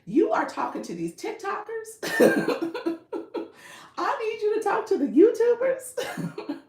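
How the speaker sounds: exaggerated high-pitched demand for attention, breaking into laughs; Diamond. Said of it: "Diamond's like".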